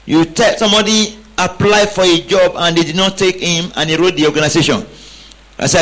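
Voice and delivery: ordinary talk, nothing marked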